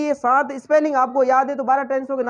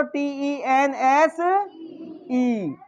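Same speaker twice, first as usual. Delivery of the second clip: each letter spelt out slowly, one by one; spelling aloud; 3 dB quieter, much slower than usual. Shown as a demonstration. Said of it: "spelling बोलो".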